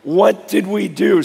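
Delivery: worn-out hoarse voice; rough, strained, tired voice. Real, not acted. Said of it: "Sorry, my voice is gone from the week".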